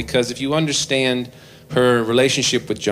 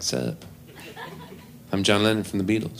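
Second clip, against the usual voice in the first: try-hard cool John; 8 dB quieter than usual. Retold or imitated. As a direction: act cool back at her. John mimics Yoko, lower.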